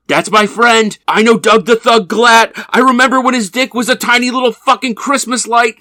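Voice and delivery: loud, high-pitched hollering; proud yelling. Retold or imitated. Friend running down the platform as the train leaves, proudly yelling. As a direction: shout it after a departing train.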